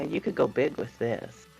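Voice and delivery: usual speaking voice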